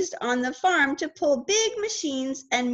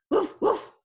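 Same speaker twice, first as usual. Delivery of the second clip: dog bark; 5 semitones higher than usual. Pretend. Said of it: "A dog says".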